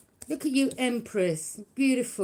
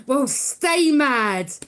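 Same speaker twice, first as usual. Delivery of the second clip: mocking taunt; 4 semitones higher, 9 dB louder, much slower than usual. Real, not acted. Mocking angry haters.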